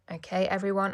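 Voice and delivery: plain reading voice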